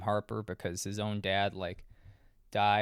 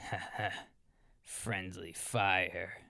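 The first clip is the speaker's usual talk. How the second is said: a lightly put-on, very slow, rough dying soldier; dead man's gravelly voice; 3 semitones lower, 5 dB quieter, slower than usual. Reviewer imitating the dying sergeant's last words, slow and gravelly. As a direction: drag out the last words like a corpse, mildly. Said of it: "He already talks like a dead person".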